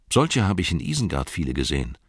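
ordinary delivery, nothing marked

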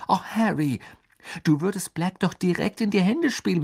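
high, urgent pleading voice; pleading girl. Acted. Girl begs Harry to keep out of danger, pleading. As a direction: beg him in a high, insistent voice.

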